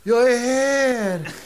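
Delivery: very slow, drawn-out and high; spirit voice from beyond. Acted. The dead husband's spirit answers the séance in a slow, high ghostly voice. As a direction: moan it like a ghost. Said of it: "då hörde de så".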